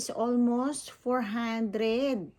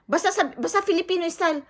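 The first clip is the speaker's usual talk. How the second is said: higher, louder, fast evasive brush-off; imitating the owner; 7 semitones higher, 5 dB louder, faster than usual. Vlogger mimics owner dodging his promise, fast and loud. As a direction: mimic the owner wriggling out of the deal. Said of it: "sabi niya, ganon".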